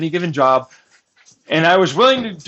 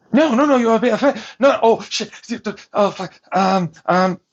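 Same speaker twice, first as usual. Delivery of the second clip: flustered, higher-pitched mimicry; mock panic; 6 semitones higher than usual. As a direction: panic in a hammed-up high voice, tripping over it. Mimicking the others panicking when caught.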